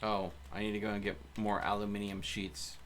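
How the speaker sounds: ordinary talk, nothing marked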